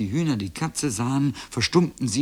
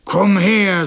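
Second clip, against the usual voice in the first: high, loud drawn-out bellow; threatening cow; 8 semitones higher, 9 dB louder than usual. A narrator's reading. Cow Gulia summoning the boy, bellowing. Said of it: "sagte Gulia".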